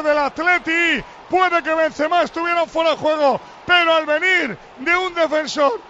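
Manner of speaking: proclaimed phrases with sustained endings; live goal commentary. Real, not acted. Commentator recounting Benzema's goal, proclaimed play-by-play. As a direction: ring out each phrase like a match announcer.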